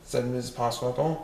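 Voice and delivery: ordinary delivery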